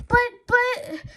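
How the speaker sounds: a high, loud stammer. A narrator's reading. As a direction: stumble over the words, rattled. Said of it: "Harold stammered".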